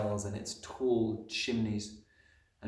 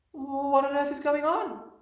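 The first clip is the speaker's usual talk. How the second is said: put-on high, drawn-out child's voice; slow, loud, high-pitched little girl's cry; a child's bewildered cry, pitched right up; crying out in alarm; 6 dB louder than usual. A narrator's reading. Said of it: "she cried".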